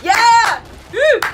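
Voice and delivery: high, loud whooping; excited cheer. Real, not acted. Viewer cheering the dog food line, excited. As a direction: cheer like your fighter just scored. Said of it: "Sorry, I'm excited".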